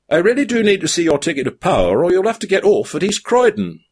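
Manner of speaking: fast, high inspector patter; the inspector insists on seeing the ticket; officious inspector. A narrator's reading. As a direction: rattle it off like a weary official. Inspector figure voice.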